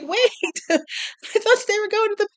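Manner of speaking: confused viewer laughing at the twist; laughing disbelief. Retold or imitated. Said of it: "And you're like".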